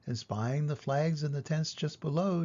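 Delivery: usual speaking voice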